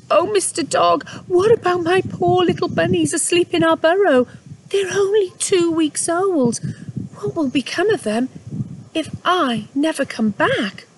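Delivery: high, pleading and anxious; worried mother. Acted. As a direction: plead for her babies in a small voice. Mother Rabbit fears for babies.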